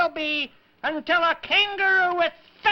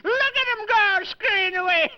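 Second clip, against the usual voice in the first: usual speaking voice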